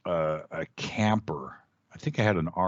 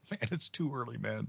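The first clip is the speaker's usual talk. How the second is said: much softer, half-awake grumble; sleepy mumble; 2 semitones higher, 12 dB quieter than usual. Retold or imitated. Groggy reply to a friend at eight in the morning, soft mumble. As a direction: brush him off like you just woke up. Woken early, brushing off friend.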